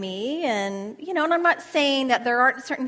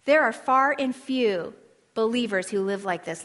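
normal talking voice

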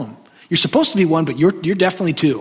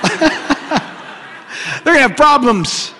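higher and slow, laughing it out; 8 semitones higher, 4 dB louder, much slower than usual. Shown as a demonstration. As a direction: stretch "They're gonna have problems" into a high, slow laugh.

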